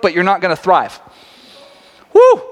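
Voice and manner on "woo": high, loud whooping cheer; cheering herself on, loud and high. Real, not acted. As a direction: let out a big celebratory woo. Whooping at own bold point.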